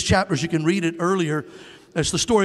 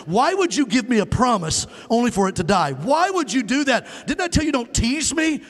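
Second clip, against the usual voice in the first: mother's anguished cry, pitch climbing high; pleading cry; 7 semitones higher than usual. Retold or imitated. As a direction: cry out higher and higher at the prophet in grief. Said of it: "she's screaming at the prophet".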